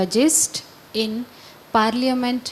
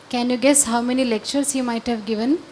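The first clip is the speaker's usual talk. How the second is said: ordinary talk, nothing marked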